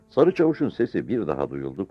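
ordinary delivery, nothing marked

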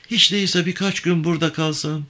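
high-pitched, pleading character voice; pleading woman. Acted. Avke asking Yavan to let her stay, pleading woman.

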